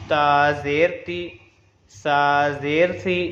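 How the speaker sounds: slow, level-toned letter chant; measured Qaida drill on an even note; spelling drill recitation. Shown as a demonstration. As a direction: stretch each letter name, then give its "ee" syllable. Recites zer drill for learners.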